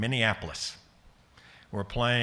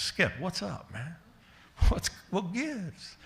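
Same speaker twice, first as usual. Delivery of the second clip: higher, softer easygoing player voice, slow at the end; voiced big ballplayer; 8 semitones higher, 3 dB quieter, slower than usual. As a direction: lightly act out the calm player. Voicing Cliff Johnson answering manager.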